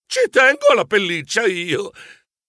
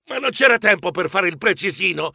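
plain reading voice

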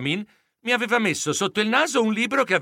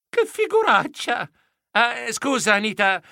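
embarrassed; 6 semitones higher than usual. A narrator's reading. Embarrassed blush.

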